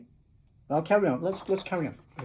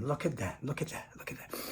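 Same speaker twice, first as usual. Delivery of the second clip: whispered look at that; whispered while showing the picture; 3 semitones lower, 14 dB quieter than usual. Real, not acted. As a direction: hush it, awed. Whispered aside.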